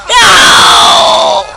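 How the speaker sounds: high, drawn-out yell; screamed no. Real, not acted. Player reacting to game mishap, long high scream. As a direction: wail it out in dismay.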